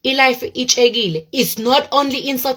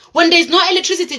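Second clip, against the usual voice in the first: ordinary talk, nothing marked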